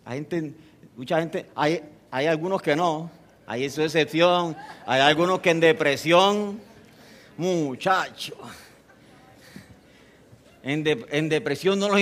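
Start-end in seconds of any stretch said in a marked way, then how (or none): none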